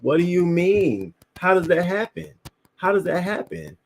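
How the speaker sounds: high-pitched disbelieving questions; incredulous. Acted. Reacting to a babysitter hurting the child, incredulous. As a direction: demand an explanation in rising disbelief.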